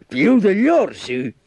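high, eager, delighted question; flattered tiger. A narrator's reading. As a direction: ask it high, lapping up the praise. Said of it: "La tigre era molto compiaciuta".

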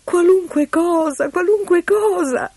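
put-on high, slow plea of a desperate girl; beg, promising anything; desperate pleading. Desperate girl accepts the bargain. (supplica acuta e disperata)

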